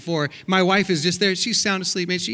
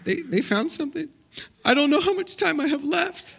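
fake tearful; 9 semitones higher than usual. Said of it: "I had tears coming".

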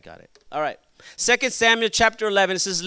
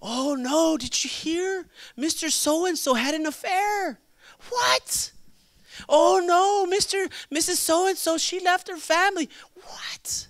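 high, gasping gossip voice; scandalized gossip, hammed-up; mock shock; 9 semitones higher than usual. Preacher imitates churchgoers hearing about an affair, mock shocked. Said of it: "We're shocked when we hear people".

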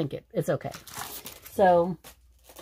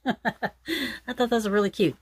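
giggling, pitched up with delight; laughing; 7 semitones higher than usual. Real, not acted. Crafter showing towels, laughing with delight. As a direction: laugh through it, charmed by them.

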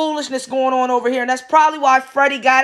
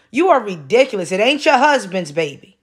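ordinary talk

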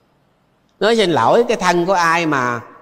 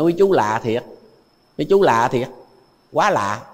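usual speaking voice

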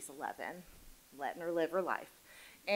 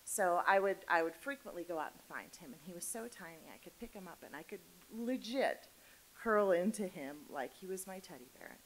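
ordinary talk, nothing marked

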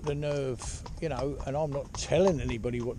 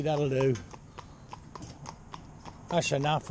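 firm tone change; 2 semitones lower, 4 dB quieter than usual. Retold or imitated. Trainer settling a mare after she paws, firm tone. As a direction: calmly tell her that's enough. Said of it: "I changed the tone of my voice entirely".

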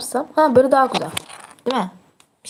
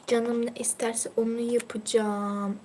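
soft slow whim; 10 dB quieter, slower than usual. Pretend. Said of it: "paşa gönlün ne isterse şey gibi oldu".

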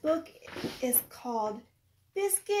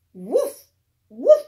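dog barks; 12 dB louder than usual. Acted. Teacher barking for Biscuit the puppy, loud and playful. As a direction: bark like a happy puppy.

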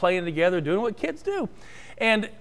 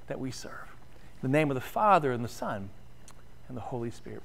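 benediction; 4 dB quieter than usual. Acted. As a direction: lay the closing blessing down gently. Closing benediction.

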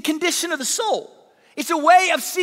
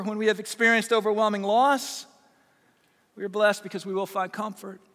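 ordinary talk

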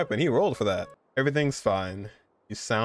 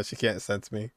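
normal talking voice

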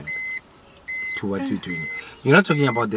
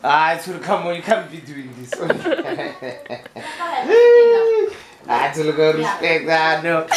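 laughing; 5 semitones higher than usual. Real, not acted. Pranked friend laughing.

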